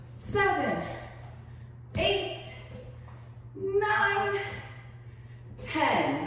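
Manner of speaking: rising, pumped rep count; energetic counting. Real, not acted. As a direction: push them through the last reps. Coach counting reps aloud.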